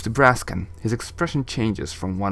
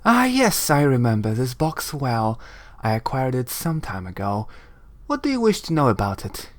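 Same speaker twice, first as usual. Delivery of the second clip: composed sorceress; 2 semitones higher than usual. Acted. Shilandra recognizes the box, lofty and composed. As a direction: recall it airily, then ask. Voices Shilandra in game dialogue.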